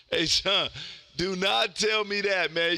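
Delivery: normal talking voice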